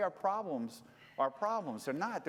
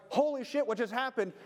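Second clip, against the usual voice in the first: startled; 6 semitones higher, 3 dB louder than usual. Retold or imitated. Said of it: "'cause I'm like".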